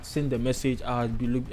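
ordinary talk

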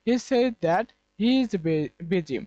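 very slow, word by word; slow example sentence. Shown as a demonstration. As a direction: read it out slowly for the class. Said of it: "আমরা এখানে conjunction হিসেবে". (ধীরে ধীরে উদাহরণ বাক্য পড়া)

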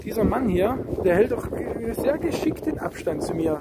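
ordinary delivery

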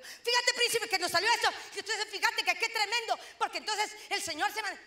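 high, loud and laughing; bursting with news to her husband, giggling; wife breathlessly excited to husband Germán, laughing; excited telling. Retold or imitated. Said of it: "Entonces yo le digo".

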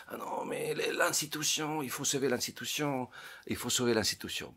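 high, whiny mock lament; mock whining. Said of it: "continuent à geindre". (imitation geignarde et aiguë)

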